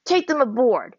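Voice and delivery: ordered. A narrator's reading. Leader to his soldiers, a loud command. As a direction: snap the order at the men. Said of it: "he ordered of his men".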